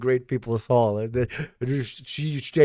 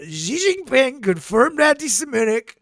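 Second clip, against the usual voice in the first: high, drawn-up mock-indignant verdict; accusing politician character; 13 semitones higher than usual. Mock accusation to Xi about his team, high indignant politician voice. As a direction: pronounce it like an outraged blustering leader.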